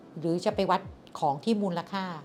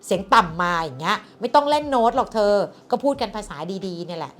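louder, higher voice scolding a partner; mock-scold your partner about raised voices; 3 semitones higher, 9 dB louder than usual. To a partner who raises their voice, a louder, higher mock scolding. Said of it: "บางทีเราจะแบบ".

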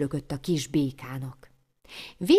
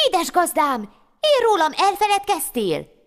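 much higher, squeaky little voice; a small plaintive creature reminding its master; tiny hair voice; 10 semitones higher, 4 dB louder than usual. Acted. The hair in his pocket reminds its master, tiny high voice.

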